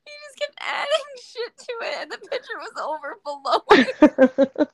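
high, squealing laughter through speech; real breathless giggling as the words tumble out; cracking up. Real, not acted. Host retelling the kid's messy pour, cracking up. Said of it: "It was cracking me up".

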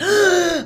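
sharp high gasp of shock; loud startled intake of breath; imitated shocked gasp. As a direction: gasp like mum seeing the videos. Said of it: "like, massive shock".

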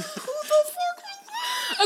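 very high, slow squeal with a laugh; swooning half-laughed shriek; mock stunned reaction. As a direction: shriek like you just heard a hot stranger. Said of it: "I'm like".

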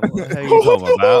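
hammed-up, terrible British accent; mock British accent. Joining friends' silly voices, exaggerated British accent. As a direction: put on a bad British accent. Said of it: "terrible fucking British accent".

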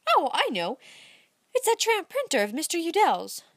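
Amy recognizing the tramp printer, excited; sudden realization. A narrator's reading. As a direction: land it like a happy discovery. Amy realizes who he resembles.

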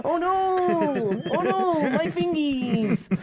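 very high mock whine; high squeaky voice. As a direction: squeal it like a cartoon. Mock panic after Aziz joke.

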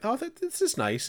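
high, touched mock reply; pleased kid voice. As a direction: play the moved kid. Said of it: "and you're like".

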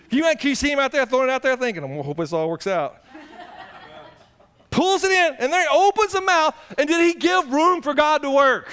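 fast, loud, rising sermon push; full-force preaching. Acted. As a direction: sweep them along.

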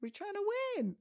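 put-on high childlike voice; imitating kid. Mom imitating her kids, high childlike. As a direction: answer like a giggly little kid, high up.

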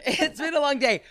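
repeating it through giggles; laughing. Real, not acted. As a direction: echo the line while laughing. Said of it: "I'm losing it".

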